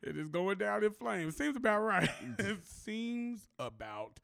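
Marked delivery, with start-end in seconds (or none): 2.4-4.2 very slow, word by word; slow, deliberate deadpan; drawn-out repeat. Real, not acted. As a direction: stretch it out slowly, dropping low and quiet at the end. Repeats own phrase for comic effect.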